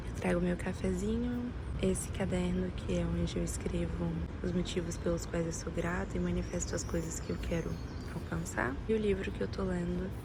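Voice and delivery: recorded outside in open air; recorded outdoors. Real, not acted. Recording her morning ritual outdoors. (gravado ao ar livre, microfone distante)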